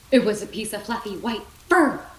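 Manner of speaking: plain reading voice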